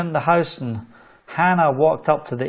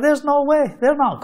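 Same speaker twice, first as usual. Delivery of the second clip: incredulous disbelief; 12 semitones higher than usual. Retold or imitated. Realtor doubting the prayer answer.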